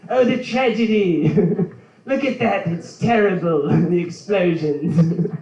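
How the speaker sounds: put-on an Australian newsreader calling a disaster in a silly Australian accent; mock Australian reporter. Comic imagines an Australian reporting a tragedy, in a mock Australian accent. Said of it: "Australians reporting ... in their funny-ass voices".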